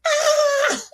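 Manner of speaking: very high fangirl shriek; excited shriek. Retold or imitated. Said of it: "And [name]'s like".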